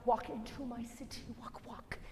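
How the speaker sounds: soft rhythmic murmur of lyrics; hushed throwaway chant; offhand demo. Shown as a demonstration. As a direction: recite it very softly, almost under the breath. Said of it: "So don't worry about".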